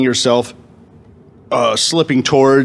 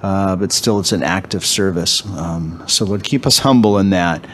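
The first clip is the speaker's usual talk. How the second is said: prayer; 4 semitones lower than usual. Acted.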